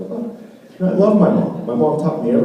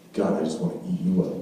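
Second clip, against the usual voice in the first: cooing at puppy; 9 semitones lower, 10 dB quieter, faster than usual. Retold or imitated.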